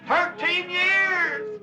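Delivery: high, slow drawn-out cry; preaching climax. Real, not acted. Preacher crying out to the congregation, stretched and high. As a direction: lift it up in praise.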